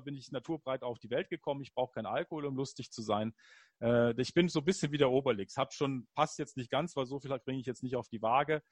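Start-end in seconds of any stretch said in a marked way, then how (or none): none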